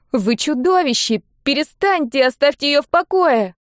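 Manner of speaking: put-on high, distressed girlish cry; girl's angry protest. A narrator's reading. Girl attacks the lord. (гневный протест девочки)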